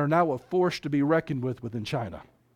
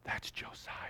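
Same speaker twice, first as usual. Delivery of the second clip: hushed whisper; 18 dB quieter than usual. Real, not acted. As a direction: whisper it very softly. Preacher drops to whisper for point.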